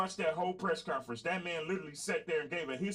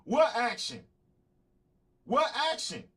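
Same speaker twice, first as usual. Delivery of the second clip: indignant; 3 semitones higher, 5 dB louder than usual. Real, not acted. Indignant challenge to president.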